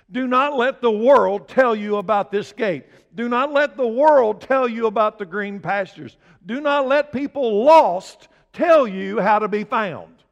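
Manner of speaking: loud, high preacher's warning; preaching at full force. Real, not acted. Pastor warning his church against the world, loud and high. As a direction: thunder it at the congregation, slowing at the end.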